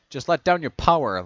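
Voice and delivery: shouted plea. A narrator's reading. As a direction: call out to talk someone down.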